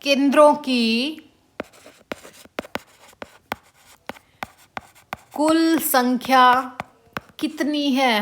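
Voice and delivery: slow, phrase by phrase with long gaps; slow dictation. Shown as a demonstration. Teacher repeating the question slowly for students to note down. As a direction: drag it out so students can write. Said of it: "क्या question आ जाए".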